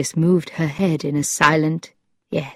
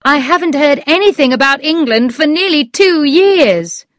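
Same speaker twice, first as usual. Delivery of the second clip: high-pitched, emphatic character line; lively storybook dialogue; Lord Struve, animated; 10 semitones higher, 4 dB louder than usual. A narrator's reading. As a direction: explain it animatedly as the man.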